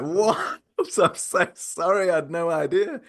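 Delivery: high-pitched retold disbelief, laughing; squeaky-high mock apology; shocked apology. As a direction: act shocked while fighting laughter.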